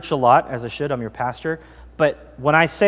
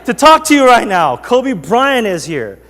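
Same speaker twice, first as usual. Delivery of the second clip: impatient, higher and louder, slowing at the end; exasperated snap; 7 semitones higher, 8 dB louder than usual. Retold or imitated. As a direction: brush Kevin off in annoyance. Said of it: "he goes".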